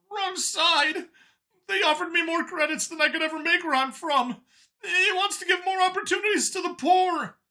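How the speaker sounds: high, loud alien protest; indignant Xeno. A narrator's reading. Xeno arguing with Vincent, high loud indignation. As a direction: defend yourself hotly.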